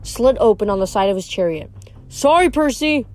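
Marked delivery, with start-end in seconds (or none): 2.1-3.1 higher, mildly raised call; yelled apology. A narrator's reading. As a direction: call it across lightly. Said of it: "he yelled".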